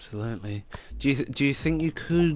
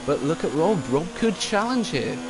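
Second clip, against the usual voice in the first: excited; 7 semitones higher than usual. Real, not acted. Commentator spots a late challenge.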